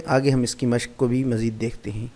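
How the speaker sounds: ordinary delivery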